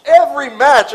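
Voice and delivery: every said loud and high; stress on every. Shown as a demonstration. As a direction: hammer home every. Repeats every match for weight.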